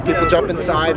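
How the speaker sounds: usual speaking voice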